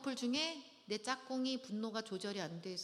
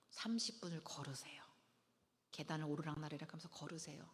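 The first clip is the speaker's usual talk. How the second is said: whispered advice; 4 semitones lower, 7 dB quieter than usual. Shown as a demonstration.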